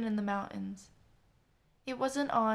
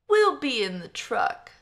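put-on loud drunk; 3 semitones higher, 10 dB louder than usual. Drunk coworker speaking.

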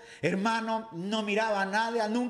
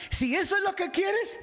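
high kindly Jesus; 10 semitones higher than usual. A narrator's reading. Jesus granting sight.

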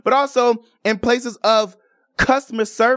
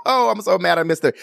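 usual speaking voice

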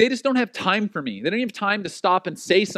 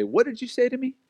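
high, incredulous mock challenge; acted offence; 6 semitones higher than usual. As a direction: pitch it up as if affronted. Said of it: "You mistakenly hear an offensive word".

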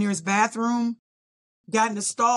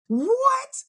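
high, disbelieving what; a stunned yelp at not being called; shocked what. Retold or imitated. Standing on the sideline, a shocked high what.

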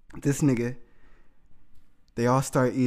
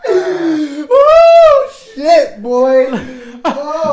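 high, loud, laugh-cry shouting; crying with laughter; 11 semitones higher, 7 dB louder, much slower than usual. Real, not acted. Storyteller howling at the punchline, crying laughter. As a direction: howl it out between laughs.

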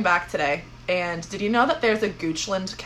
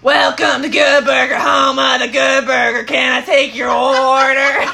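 loud, higher, slow goofy cashier; Good Burger cashier; 5 semitones higher, 8 dB louder, slower than usual. Acted. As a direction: shout it like a dopey fast-food clerk. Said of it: "Hit me up for all your impersonations".

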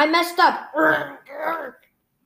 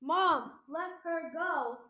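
quiet; 14 dB quieter, slower than usual. Acted. Quiet threat to captor.

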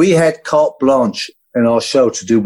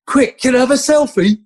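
eager fan; 12 semitones higher than usual. Retold or imitated. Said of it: "He goes".

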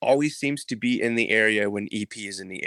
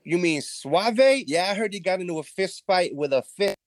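put-on bravado; 9 semitones higher than usual. EP disguising his voice before the gang, faked bravado. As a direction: fake confidence to hide who he is. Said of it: "bravado in his voice".

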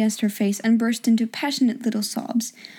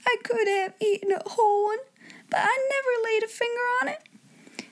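high soft tearful voice; crying servant girl; 12 semitones higher, 4 dB quieter than usual. A narrator's reading. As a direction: sob it out. Becky denies theft, crying.

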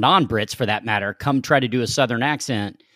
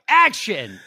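director's call; 8 semitones higher than usual. Retold or imitated. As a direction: call it out to the set. Director calls action.

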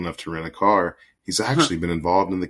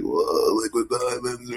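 high awed stoner voice; 6 semitones higher, slower than usual. Retold or imitated. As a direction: gush like a dazzled pothead. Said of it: "they read this, like".

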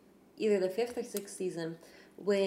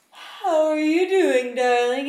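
slow, louder and higher sweet talk; flirty celebrity; 6 semitones higher, 9 dB louder, slower than usual. Retold or imitated. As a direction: chat her up, slowly. Actor flirting with fan.

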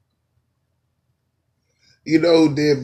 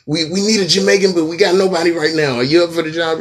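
higher, louder, hurried acted plea; urgently recruit someone, pitched up; frantic recruiter; 7 semitones higher, 6 dB louder, faster than usual. Casting person needs a Jamaican now, mock frantic recruiting.

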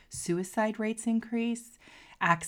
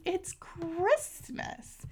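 high, slow, drawn-out plea; whiny friend; 11 semitones higher, much slower than usual. Retold or imitated. Friend repeating her excuse to Stephanie, high and slow. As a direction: stretch it out as if it explains everything. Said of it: "And her response is".

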